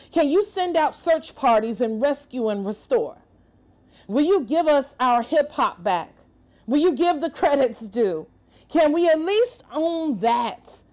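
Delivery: ordinary delivery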